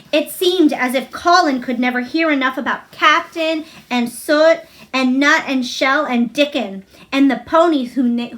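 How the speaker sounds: ordinary delivery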